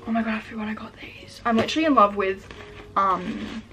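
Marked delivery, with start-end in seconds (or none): 0.1-1.4 hushed, thrilled whisper; excited whisper. Real, not acted. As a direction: whisper your delight. Surprised by forgotten shoes.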